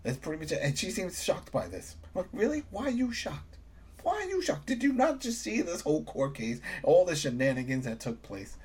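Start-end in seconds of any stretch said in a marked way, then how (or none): 2.1-6.3 high, disbelieving really and why; incredulous. Real, not acted. Fan scolding the shocked character, high incredulous disbelief. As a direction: ask in high-pitched disbelief.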